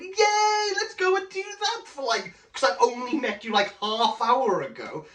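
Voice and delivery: high, gushing mock cheer, then settling; gleeful yay. Charles gleefully agreeing to kidnap plan, high mock cheer. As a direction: squeal it like a delighted pompous old actor.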